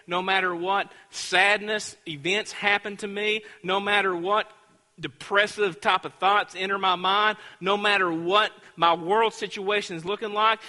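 driving, emphatic pulpit delivery; fervent preaching. Real, not acted. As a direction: proclaim it with mounting conviction. Preaches a declaration of faith.

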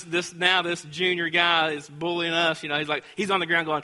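ordinary talk, nothing marked

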